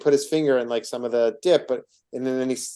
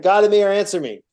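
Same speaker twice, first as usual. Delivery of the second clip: higher, louder cry for help; exclaimed plea; 6 semitones higher, 5 dB louder than usual. Retold or imitated. Guard crying out to God while being crucified. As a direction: call it out as a desperate appeal. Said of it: "He exclaimed".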